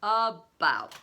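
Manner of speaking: put-on a loud, scornful echo of the boy's word; mocking the boy's vague answer, loud; sneering. A narrator's reading. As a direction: sneer the word back at him. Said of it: "with something like a sneer".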